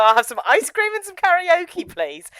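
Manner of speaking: high, loud, chirpy mock order; mock priest order. The podcaster imagines two priests at a karaoke counter, chirpy. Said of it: "two priests walk in and go".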